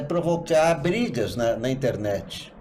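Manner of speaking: ordinary talk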